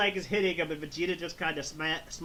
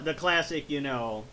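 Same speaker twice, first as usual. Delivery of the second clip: ordinary delivery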